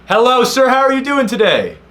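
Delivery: very high, loud singsong greeting; hammed-up squealed pleasantry; mock-polite shout.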